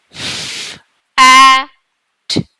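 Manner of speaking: F, A, T each said apart, with pauses; sounding out letters. Shown as a demonstration. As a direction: say F, A, T one letter at a time. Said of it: "Let's say the sounds".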